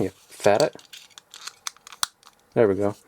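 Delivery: ordinary talk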